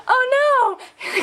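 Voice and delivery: high-pitched giggly wail; mock dismay. Roommates watching oranges roll away, mock dismay. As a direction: wail it in hammed-up alarm.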